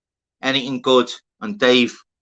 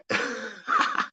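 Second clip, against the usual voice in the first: high-pitched, soft cackle; high giggling laugh; 8 dB quieter than usual. Real, not acted. Streamer remembering cereal with water, high helpless laugh. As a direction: crack up at the memory.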